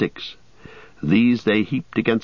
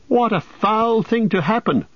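high-pitched, distraught outcry; wild cry; 12 semitones higher than usual. A narrator's reading. Frodo finding his friends trapped in the tree, wildly distraught. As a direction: cry out in dismay, pitched well up. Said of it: "cried Frodo wildly".

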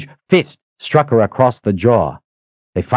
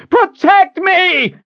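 very high, drawn-out scream; screamed command; 4 dB louder, much slower than usual. A narrator's reading. Pinned woman ordering the man to defend her, screamed. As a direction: scream it out desperately. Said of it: "she screamed".

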